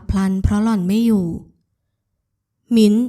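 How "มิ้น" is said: a short raised-voice call; loud call. A narrator's reading. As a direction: call out the name, louder. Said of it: "ปิยพัฒน์แผดเสียงเรียก". (ตะโกนเรียก)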